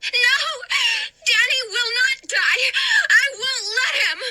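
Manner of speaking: high, loud, crying defiance; defiant shout. Acted. Hannah defying Danny's ghost, loud crying shout.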